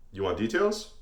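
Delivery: normal talking voice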